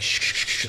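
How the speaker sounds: whispered shh imitating a water sprayer; mock spraying noise; spray hiss imitation. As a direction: hiss like a spray bottle going off. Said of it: "So she's like".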